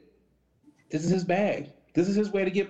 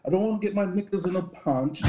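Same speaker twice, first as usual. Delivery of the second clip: a lightly put-on, bad British accent, pitched a little higher; mock Piers Morgan in a clumsy British accent, slightly higher; 4 semitones higher than usual. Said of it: "That was the worst accent ever".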